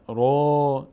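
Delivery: ره with a thin, fronted e-like vowel; thin wrong ra. Shown as a demonstration. Teacher showing what we don't say, thin fronted ره. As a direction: flatten the vowel into a light ره.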